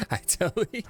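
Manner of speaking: giggling through the words; can't stop laughing at the joke; words broken up by laughter. Real, not acted.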